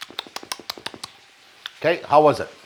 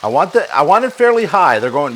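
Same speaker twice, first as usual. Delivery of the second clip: ordinary delivery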